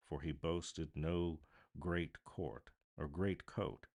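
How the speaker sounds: ordinary delivery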